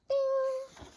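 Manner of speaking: high sung ding chime; high bell-like ding. Acted. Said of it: "page-turn signal".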